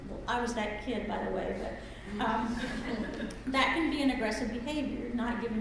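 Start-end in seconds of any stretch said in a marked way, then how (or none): none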